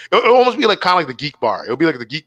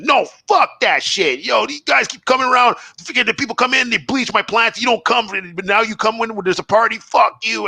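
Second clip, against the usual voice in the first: higher-pitched mock rant of an angry wife; snapping wife; 8 semitones higher than usual. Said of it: "his wife snapped".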